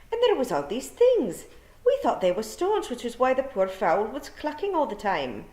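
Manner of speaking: high, broad Scots wee-man voice, tumbling out; Scottish pictsie voice. A narrator's reading. Tiny Scots fairy man excusing himself to Tiffany, high broad Scots. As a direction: gabble it like a wee Scots rogue caught out. Feegle explaining.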